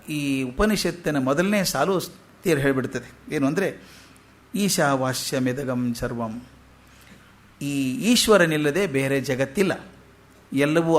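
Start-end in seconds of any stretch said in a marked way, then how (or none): 4.6-6.4 scripture recitation. Acted. Quoting the Upanishad verse.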